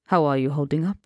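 put-on deep, smooth Persian accent purr. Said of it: "Rassam's Persian accent purred deep and smooth".

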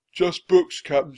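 high, loud pirate henchman; shouting back. A narrator's reading. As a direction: call a report to the captain. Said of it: "shouted Stinky".

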